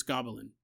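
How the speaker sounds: "goblin" as three syllables, GOB-uh-lin; low, offhand GOB-uh-lin for "goblin"; stubborn mispronunciation. Retold or imitated. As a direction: dismissively keep the extra "uh" in "goblin". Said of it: "Whatever, it's goblin".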